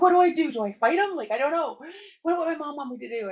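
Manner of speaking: spiral into a panic, voice jumping up; shocked panic. Retold or imitated. Retelling her panic at seeing the Prime Minister, high and flustered.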